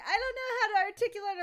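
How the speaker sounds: high, fast mock whine; whiny imitation of her friend. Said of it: "you going, like".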